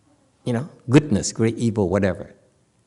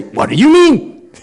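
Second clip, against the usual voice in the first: high, pointed demand; sharp challenge; 9 semitones higher, 4 dB louder than usual. Shown as a demonstration. Teacher showing his own blunt way, high and pointed. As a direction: push back sharply. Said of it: "I would say".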